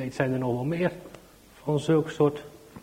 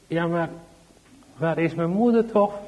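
put-on anxious little child; 5 semitones higher, 4 dB louder than usual. Child keeps asking for mother.

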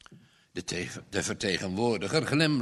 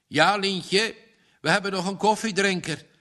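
quick, higher joking tone; 7 semitones higher, 4 dB louder than usual. A narrator's reading. Father teases girl about extra guest, joking. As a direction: tease her lightly. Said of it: "grapte Rien".

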